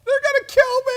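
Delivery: very high, loud, panicked shriek; terrified crying shout about her parents; imitated hysterical wail. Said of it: "literally uncontrollable".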